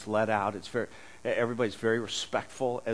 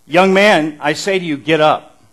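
loud, commanding call to the dead; commanding shout; 4 semitones higher, 14 dB louder than usual. Acted. Preacher voicing Jesus raising the dead, commanding shout. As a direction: boom it out like a command to rise.

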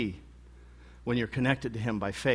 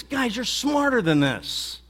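higher, slow, exasperated appeal; frustrated; 10 semitones higher, slower than usual. Acted. Jesus telling his disciples they know better, exasperated. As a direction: drag it out, fed up but pleading. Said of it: "in a sort of a frustrating way".

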